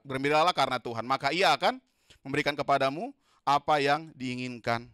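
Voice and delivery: loud, emphatic scripture reading; preaching at full force. Acted. Preacher leading the church through Psalm 37, loud. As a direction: proclaim the verse to the church.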